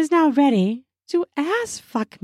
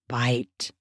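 low, soft male command; a hint of a quiet, low order; lover's low command; 10 semitones lower, 7 dB quieter than usual. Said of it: "my lover says".

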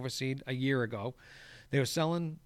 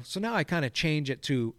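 ordinary talk, nothing marked

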